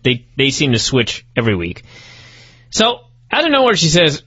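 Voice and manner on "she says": ordinary delivery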